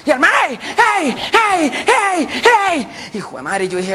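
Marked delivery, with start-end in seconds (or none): 0.5-3.1 high, repeated hailing cries; calling out. Acted. Hitchhiker in the rain hailing a driver, calling out. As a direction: flag down a car with your voice. Man hails car from roadside.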